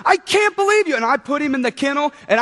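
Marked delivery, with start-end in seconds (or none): 0.1-1.0 angry yelling. Retold or imitated. Owner yelling at puppy after accident, angry. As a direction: lose it and yell at the dog. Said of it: "I was so angry".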